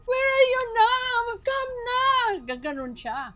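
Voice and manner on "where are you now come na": high, loud, slow crying where are you now; tearful demand. Retold or imitated. Daughter crying to her mother to come, sobbing.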